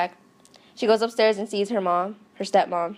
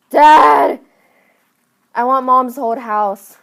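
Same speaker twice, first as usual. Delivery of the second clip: loud, higher, slow drawn-out yell; demanding shout; 4 semitones higher, 11 dB louder, much slower than usual. Retold or imitated. Entitled daughter demands a house from Dad, shouted demand. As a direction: bellow for Dad like a spoiled child.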